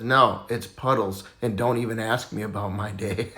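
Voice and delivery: a fed-up duck grumbling low; glum duck. Acted. The third duck answers the bartender, low and glum.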